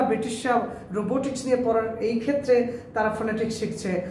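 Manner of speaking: ordinary talk, nothing marked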